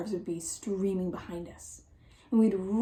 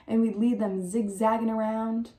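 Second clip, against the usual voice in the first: plain reading voice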